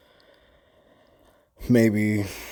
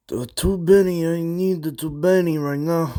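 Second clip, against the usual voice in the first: high, loud imitation of a demanding brother; mock brother voice; 9 semitones higher, 5 dB louder than usual. Mocking his brother's demand at the door, high loud mimicry. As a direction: mimic your brother pitched way up, loudly.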